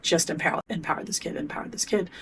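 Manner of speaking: soft, almost whispered self-talk; a hushed, breathy inner chant; inner mantra. Retold or imitated. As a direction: murmur it to yourself like a focus mantra. Inner focus on empowering the kid.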